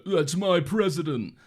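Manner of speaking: loud, drawn-out mocking yell; mock a die-hard supporter, loud and high; Republican dipshit. Friend imitating Republicans cheering their pick, mock dipshit yell. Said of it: "Republican dipshits are gonna go".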